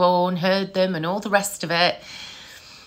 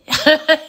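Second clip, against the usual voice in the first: high, louder burst of laughter; amused laugh; 8 semitones higher, 6 dB louder than usual. Real, not acted. Mum amused by her weird kids, real laughing burst. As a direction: laugh out at your own story.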